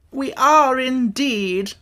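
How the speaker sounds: a learned bug, drawn out; educated insect. A narrator's reading. Wogglebug voice.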